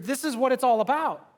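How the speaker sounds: rising sermon fervor; preaching at full force. Acted. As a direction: drive the point home to the crowd. Preaching climax.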